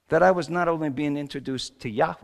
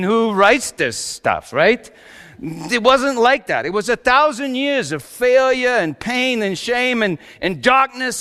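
ordinary talk, nothing marked

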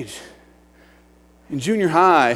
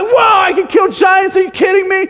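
high, loud mock astonishment; hammed-up wide-eyed excitement; amazed little kids; 10 semitones higher, 5 dB louder than usual. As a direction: burst out like a child who's just been thrilled. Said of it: "little kids are like".

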